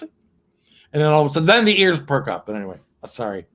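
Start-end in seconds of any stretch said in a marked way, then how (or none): none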